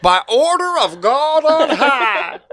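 high, loud, drawn-out Southern proclamation; hammed-up Southern declaration, long vowels; Southern accent. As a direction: proclaim it in a big Southern drawl. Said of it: "Again, I went Southern".

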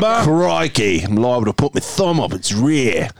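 hammed-up Aussie wildlife presenter; Australian accent. Shown as a demonstration. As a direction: exclaim like an Australian animal wrangler. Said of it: "in your best Australian accent".

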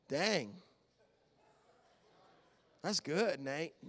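low, very soft, impressed aside; quietly impressed. Retold or imitated. Dad impressed by his son's insight, quietly admiring. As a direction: drop to a hushed, private admission. Retold impressed reply to son.

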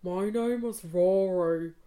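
slow, low put-on man's voice; mocking a date's low slow voice; low voice Rory impression. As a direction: do his slow deep self-serious drawl. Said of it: "he kind of spoke like this".

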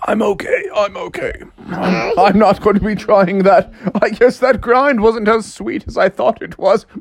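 acted post-crash bravado; hurt cartoon dad. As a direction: insist you're okay after a big tumble. Hurt dad says he's okay.

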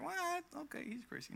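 high squeak of mock disbelief. Imagined listener scoffing at her claim, mock surprised. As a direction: play a listener's mock shock.

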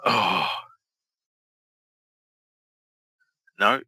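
sudden loud oh; startled exclamation. Real, not acted. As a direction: gasp at the miss. Reacting to a missed move.

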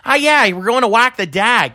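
hammed-up Wilkes-Barre local; exaggerated Pennsylvania Wilkes-Barre accent. Shown as a demonstration. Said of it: "the Wilkes-Barre accent has".

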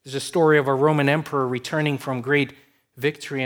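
normal talking voice

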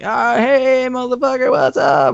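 put-on drunk bandmate. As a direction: play the wasted singer, way up high. Drunk bandmate greeting, voiced in story.

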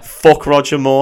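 mock outburst. Mock outburst, laughs follow.

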